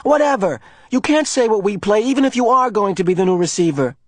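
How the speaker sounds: put-on boy resentfully arguing; irritated retort. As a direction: brush him off.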